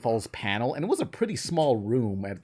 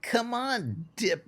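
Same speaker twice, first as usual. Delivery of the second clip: high, coaxing cartoon voice; Mabel impression; 10 semitones higher, much slower than usual. Cartoon sister pressuring her brother, high coaxing impression. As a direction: coax in a raised put-on voice. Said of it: "but Mabel smoking crack is wrong".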